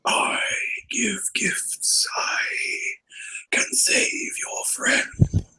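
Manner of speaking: slow, unvoiced, tempting whisper. Game master as tapestry tempting the approaching character, slow whisper. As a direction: whisper it softly, drawing out every phrase. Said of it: "you can hear it whispering".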